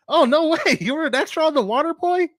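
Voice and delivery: high, loud burst of surprise; amazed disbelief. Real, not acted. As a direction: react with thrilled, real astonishment. Learns viewer was film extra.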